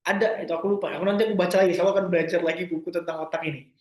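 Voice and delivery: ordinary talk, nothing marked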